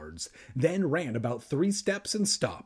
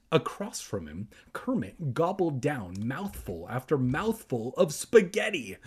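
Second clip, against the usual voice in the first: ordinary delivery, nothing marked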